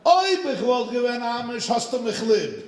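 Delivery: high, loud mock proclamation in Yiddish; hammed-up gotcha line in Yiddish; triumphant exclamation. The rabbi repeats his Yiddish line to the woman, triumphant. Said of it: "He went like this".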